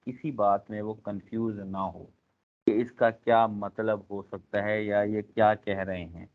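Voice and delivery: ordinary talk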